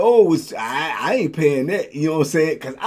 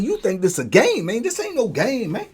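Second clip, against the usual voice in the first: ordinary delivery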